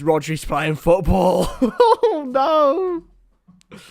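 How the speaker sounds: words breaking into high laughter; laughing. Real, not acted. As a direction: crack up while commentating. Cracks up over favourite player.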